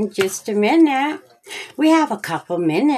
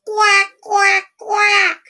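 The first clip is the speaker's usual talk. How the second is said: much higher, louder quacking; duck quacks; 13 semitones higher, 3 dB louder, slower than usual. Acted. Boy pretends to be duck, yelling.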